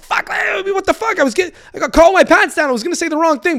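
high-pitched, flustered protest; defensive. Retold or imitated. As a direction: plead your case in a high voice. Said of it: "I was also kinda defensive".

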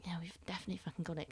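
fast, excited whisper; whispered excitement. Retold or imitated. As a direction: hiss it fast like a secret you can't keep. Secretly sure they won the star.

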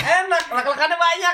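speaking through laughter; laughing. Real, not acted. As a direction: giggle through it. Still laughing after joke.

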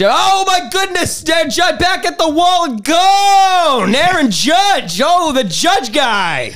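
shouted, high and slow announcer call; home run call. Pretend. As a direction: scream it like the ball is gone. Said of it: "as an announcer".

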